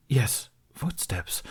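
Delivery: slow breathless whisper. A narrator's reading. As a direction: hush it, as if listening. Hearing footsteps while hiding.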